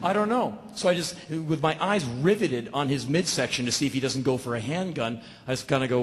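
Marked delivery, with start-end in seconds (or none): none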